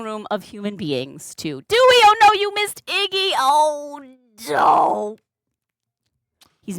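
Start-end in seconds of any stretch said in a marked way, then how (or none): 1.6-5.2 high, drawn-out oh no; mock-tragic dismay. Real, not acted. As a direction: lament it high, for a viewer who missed out. Lamenting that viewer missed the cat.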